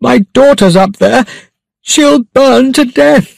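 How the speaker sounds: very high, frantic pleading; desperate cry. A narrator's reading. Father pleading for his trapped daughter, crying out. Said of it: "he cried".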